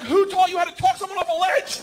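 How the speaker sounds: high shouted retort; acted-out panicked indignation; incredulous yell. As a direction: yell back over engine noise. Yelling back over plane engines.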